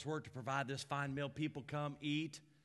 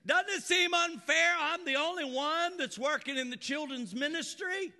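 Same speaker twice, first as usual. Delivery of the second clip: put-upon Martha griping to Jesus; whiny Martha; 12 semitones higher, 6 dB louder than usual. Acted. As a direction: whine like an overworked sister. Preacher voicing complaining Martha.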